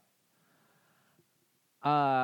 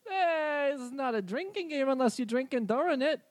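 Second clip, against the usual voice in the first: higher, friendly reindeer voice; 8 semitones higher, 5 dB louder than usual. Acted. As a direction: play the chatty reindeer.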